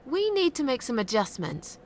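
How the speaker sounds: high-pitched, cheerful announcement; cheerful lilt from a high start. Acted. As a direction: start high and chirpy, then glide down. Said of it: "sounded very cheerful when you said that".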